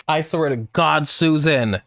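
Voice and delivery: ordinary talk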